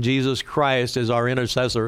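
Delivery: ordinary talk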